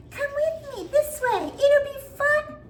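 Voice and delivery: put-on very high, slow, luring child voice; creepy little boy. Mimicking the boy from her short, very high creepy lure. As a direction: coax them in like a creepy kid from a horror short. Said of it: "your own self saying".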